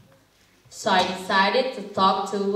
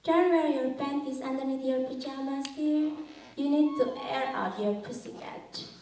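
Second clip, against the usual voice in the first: higher, quick, put-on motherly voice; advising mother; 5 semitones higher, 5 dB quieter than usual. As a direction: play a mom giving soft, high-pitched advice. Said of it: "her mom used to tell her".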